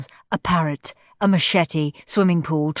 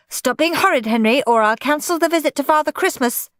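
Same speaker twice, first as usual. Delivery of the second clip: raised, higher scolding call; shouting; 9 semitones higher than usual. A narrator's reading. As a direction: threaten him to make him stop. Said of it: "shouted Mum".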